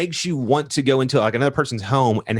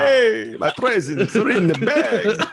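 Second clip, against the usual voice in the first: Santa voice; 9 semitones higher, slower than usual. Pretend. Playing Santa, laughing.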